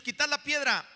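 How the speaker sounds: commanding Jesus. Acted.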